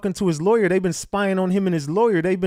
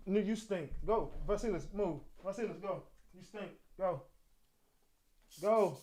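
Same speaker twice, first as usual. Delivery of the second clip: hushed scolding; 16 dB quieter than usual. Real, not acted. Streamer quietly shooing his smelly dog from under the desk, hushed scolding. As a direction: quietly shoo the dog away.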